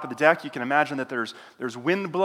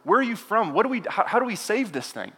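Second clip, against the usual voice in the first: higher, fast, tumbling panicked questions; frantic sailors; 7 semitones higher than usual. Acted. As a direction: pepper him with questions in a panic. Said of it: "frantically asking questions".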